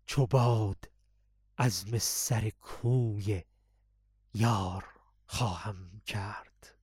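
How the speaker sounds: low, slow and soft recitation; deep voice. Shown as a demonstration. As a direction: drop the voice deep and draw the words out.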